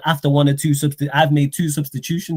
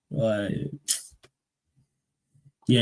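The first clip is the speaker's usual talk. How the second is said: normal talking voice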